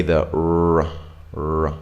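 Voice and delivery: R said with lips rounded as for W; rounded, held R; R sound demo. Shown as a demonstration. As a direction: curl the tongue back for a drawn-out R. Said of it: "think of a W sound".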